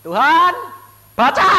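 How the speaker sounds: high, loud plea to God; loud prayer. Retold or imitated. Preacher kneeling with the telegram, crying out to God loudly. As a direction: cry out and demand he read it. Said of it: "saya bawa gini".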